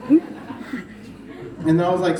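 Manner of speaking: ordinary talk, nothing marked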